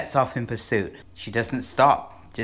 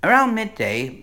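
usual speaking voice

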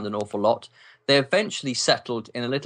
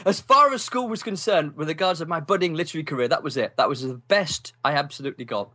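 ordinary talk

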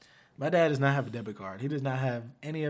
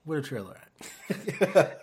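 fed-up customer; 2 semitones higher, 4 dB louder, much slower than usual. Retold or imitated. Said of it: "she was like".

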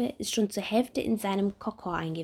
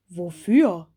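puzzled doctor; 10 dB louder than usual. Acted. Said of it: "schaut Bommel verwundert an".

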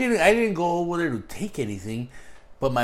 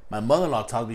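ordinary talk

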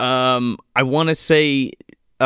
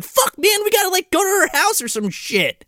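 very high, whiny dude voice; mock panicked kid. Kids deciding to visit the house, high mock panic. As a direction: imitate a worked-up kid, pitched way up.

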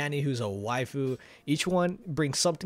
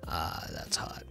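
breathy voice; 9 semitones lower, 7 dB quieter, slower than usual. Pretend. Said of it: "Freya would start doing Freya voice".

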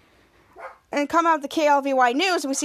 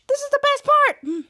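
higher, excited insistence; mock eagerness; 10 semitones higher, 3 dB louder than usual. Ray shushing the kids for his ad, eager. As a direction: insist eagerly, pitched well up.

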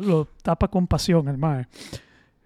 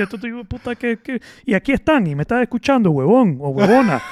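higher, louder, laughing insult; mock critic voice; 5 semitones higher, 6 dB louder than usual. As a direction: play an angry listener. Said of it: "deben decir".

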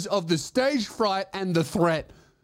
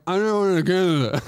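mock slurred whine, a bit louder; whining refusal; 4 dB louder than usual. Patient refusing the catheter, mock whiny slur.

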